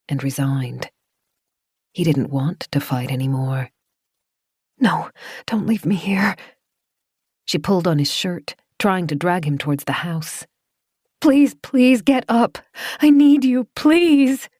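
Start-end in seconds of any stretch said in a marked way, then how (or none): 4.8-6.4 higher, unvoiced plea; broken whisper. Claire whispering to Doran as he gives up. 11.2-14.5 slow, high, loud pleading; desperate begging. Acted. Claire dragging Doran toward the house, slow loud begging.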